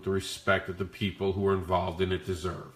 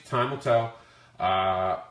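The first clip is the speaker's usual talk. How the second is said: ordinary talk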